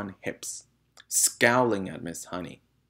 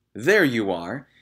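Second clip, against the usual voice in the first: loud gloating cry; triumphant cry; 3 semitones higher, 8 dB louder than usual. A narrator's reading. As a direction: crow over the catch. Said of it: "cried the Trunchbull, triumphant".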